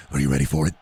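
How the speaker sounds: mock butcher voice. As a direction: drop way down like a creepy butcher teasing a customer. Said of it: "he looks at me and he says".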